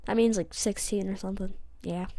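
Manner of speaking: ordinary talk, nothing marked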